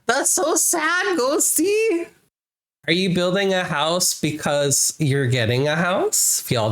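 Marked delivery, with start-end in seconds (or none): 0.1-2.1 high, slow pouty sympathy; mock sad. Streamer mock-pouting to Ghosty about the sad news. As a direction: coo it with hammed-up sadness.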